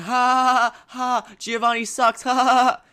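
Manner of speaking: high, loud fake haw-haw jeering; demeaning mock laugh. Friends laughing at a losing player, demeaning jeer. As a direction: jeer with big forced ha-has. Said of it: "negative, demeaning way".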